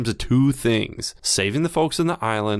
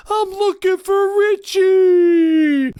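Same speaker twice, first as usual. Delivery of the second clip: very slow, high, loud mock tough-guy line; Steven Seagal impression; 7 dB louder, much slower than usual. Quoting Steven Seagal's entrance in a slow, loud hammed-up voice. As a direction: drag it out like a hammed-up action hero. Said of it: "Above the Law with Steven Seagal".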